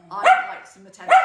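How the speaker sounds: very high, loud squeaky pet voice; voicing the dog. Speaking for the dog who wants attention, squeaky.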